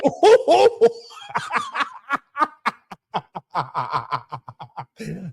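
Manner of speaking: helpless drawn-out laughing fit; long laugh. Real, not acted. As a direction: crack up, loud then dying down. Bursts out laughing at a clip.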